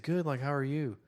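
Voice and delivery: lightly voiced awkward teen reply; put-on teen voice. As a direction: imitate stilted small talk. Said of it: "give them the voices that I heard".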